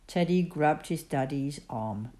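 ordinary delivery, nothing marked

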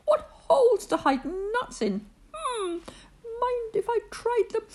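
eager squirrel. Acted. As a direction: sniff out a treasure. Squirrel eyeing the holes.

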